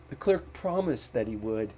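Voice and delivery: usual speaking voice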